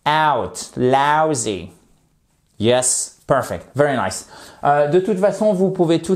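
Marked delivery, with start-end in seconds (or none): 0.1-1.7 "out", then "lousy" with the same vowel; match the OU of "lousy" to "out"; vowel demonstration. Shown as a demonstration. Teacher links two words for learners, clear pronunciation. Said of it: "ça se prononce exactement comme dans out".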